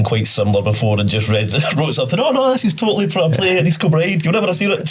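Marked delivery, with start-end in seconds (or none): 2.2-4.9 high-pitched mock cover-up; breezy bluff. Acting out a bluffed excuse.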